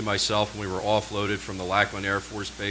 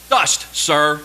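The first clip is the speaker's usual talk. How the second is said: loud, higher, at-attention answer; stated loudly; 6 semitones higher, 8 dB louder than usual. Retold or imitated. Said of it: "I stated".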